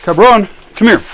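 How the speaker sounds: loud high call to the dog. Real, not acted. Calling dog over.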